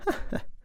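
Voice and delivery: small, soft scoffing laugh; mocking laugh. A narrator's reading. She laughs at his confession, softly mocking. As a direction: let out a brief, quiet laugh of derision. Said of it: "قالت له بسخرية".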